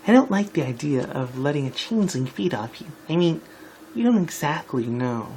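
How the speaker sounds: a hint of a put-on character voice, hesitant; Twilight. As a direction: voice a doubtful character, stumbling a little. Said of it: "Twilight, Twilight started".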